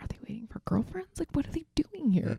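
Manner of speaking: voiceless, hushed aside; conspiratorial whisper. Retold or imitated. Guest replaying gossip about men in the cinema, whispered. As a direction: whisper it to a friend, puzzled. Friends privately wondering about two dudes.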